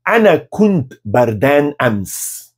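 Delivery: "Ana kunt bardan ams" said very slowly; very slow model; slow model phrase. Shown as a demonstration. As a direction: dictate slowly for repetition. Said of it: "Repita depois de mim".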